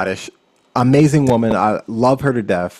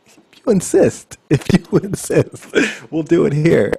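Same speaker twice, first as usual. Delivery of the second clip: higher, laughing fake reluctance; mock reluctant; 6 semitones higher than usual. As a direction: pretend to be humble accepting.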